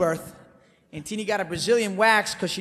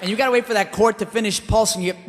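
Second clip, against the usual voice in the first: ordinary delivery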